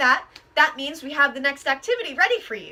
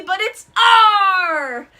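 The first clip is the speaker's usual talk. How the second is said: slow, loud, growled pirate R; 4 semitones higher, 8 dB louder, much slower than usual. Shown as a demonstration. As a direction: roll out a big pirate growl. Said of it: "Can everybody say R?".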